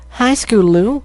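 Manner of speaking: high, lightly voiced young filly greeting; Cherry voice. Little filly greeting her friend, high voice. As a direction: call out brightly as the little filly.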